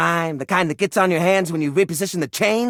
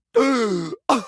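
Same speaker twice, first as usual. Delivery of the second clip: sharp offended gasp; shocked gasp; 3 semitones higher than usual. Acted. Collector hears his washcloth called a rag, offended gasp. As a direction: gasp as if insulted.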